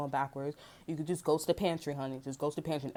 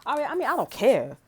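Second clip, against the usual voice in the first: higher, much louder retold retort; mock outburst; 6 semitones higher, 8 dB louder than usual. Retelling a retort to shelter staff, loud. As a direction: blow up at them, half joking. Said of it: "I'm like".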